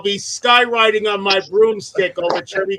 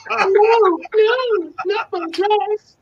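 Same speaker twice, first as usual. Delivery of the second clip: a hammed-up shriek of protest over her dress, slower on the last words; mock dress panic; 13 semitones higher, much slower than usual. Witch refusing to hand over her dress, squealing high. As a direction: squeal it high and far above her own voice.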